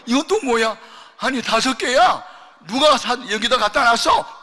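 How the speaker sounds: high, loud, puzzled "what's this"; surprised question. Retold or imitated. Mother finding a watermelon, surprised and loud. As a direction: exclaim at the found fruit. Mother finds more fruit.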